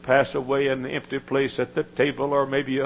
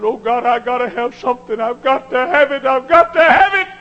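higher, louder, building cry; a son on his face in the sand crying out for power; desperate pleading; 11 semitones higher, 10 dB louder than usual. Retold or imitated. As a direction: beg God harder with every line.

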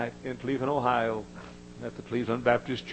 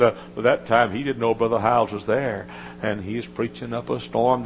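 ordinary delivery

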